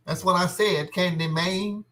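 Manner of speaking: high put-on voice saying Candy Man; silly voice. Teasing his co-host over the movie name, high silly voice.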